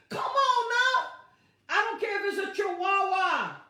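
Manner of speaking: high, loud incredulous outburst; preaching exclamation. Real, not acted. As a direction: call it out in disbelief to the church.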